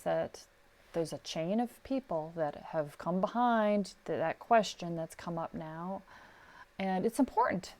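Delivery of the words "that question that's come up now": ordinary talk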